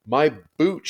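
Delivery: put-on high, loud childish wail; a girl's loud wail over her boots. A narrator's reading. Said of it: "she wailed".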